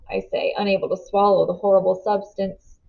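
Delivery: ordinary delivery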